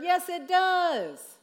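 drawn-out forceful contradiction; emphatic rebuttal. Acted. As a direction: insist it really does hurt. Countering the brave denial.

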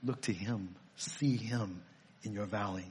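gentle appeal. Real, not acted. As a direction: draw them in gently.

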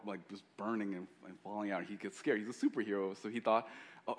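ordinary talk, nothing marked

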